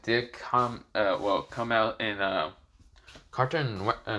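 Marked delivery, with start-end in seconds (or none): none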